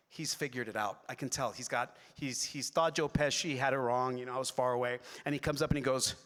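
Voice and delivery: normal talking voice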